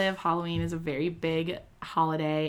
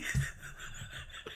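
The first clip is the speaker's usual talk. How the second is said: breathless unvoiced giggle; giggling laugh; 11 dB quieter than usual. Real, not acted. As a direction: laugh under your breath. Laughing at rapper name.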